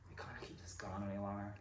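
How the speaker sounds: fast, soft whisper. Acted. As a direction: whisper it so no one hears. Breaking up in bed at night.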